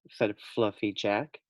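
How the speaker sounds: usual speaking voice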